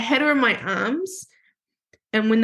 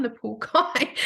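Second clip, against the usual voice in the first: ordinary talk, nothing marked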